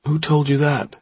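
put-on mildly drunk question; a touch of drunken airiness; Craig drunk. A narrator's reading. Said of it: "He sounded drunk".

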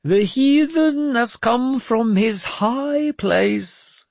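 very high, loud, very slow chant; prophesy from the pulpit; solemn intoning; intoned. Acted. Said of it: "Jeremias intoned".

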